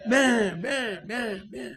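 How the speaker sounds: lightly imitated goat bleating. Lion cub caught by lion, bleating like a goat. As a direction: bleat it like a frightened kid.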